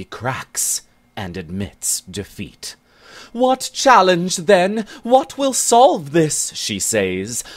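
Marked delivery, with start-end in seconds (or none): 3.3-6.5 much higher, louder nervous question; alarmed. Acted. Bennet alarmed by Ginger's stony gaze: high nervous asking. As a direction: ask it in a high, worried voice. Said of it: "alarmed and concerned".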